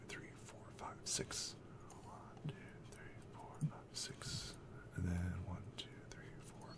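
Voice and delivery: voiceless, very soft whisper; whispered counting. Real, not acted. Game master counting to himself, barely audible whisper. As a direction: count under your breath.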